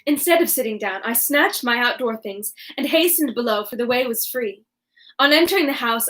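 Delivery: plain reading voice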